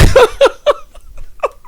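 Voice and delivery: loud, high cackle; high-pitched fit of laughter; real laugh. Real, not acted. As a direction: burst out laughing mid-sentence. Laughs at the narrated absurdity.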